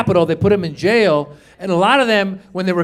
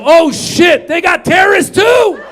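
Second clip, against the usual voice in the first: high loud surprised yell; mock surprised shout; 10 semitones higher, 6 dB louder, slower than usual. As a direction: shout it like a sudden discovery. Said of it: "I go".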